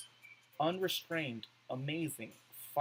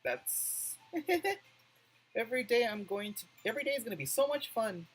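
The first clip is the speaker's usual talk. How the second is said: Sayori voice; 7 semitones higher than usual. Acted. Voicing Sayori's line in game.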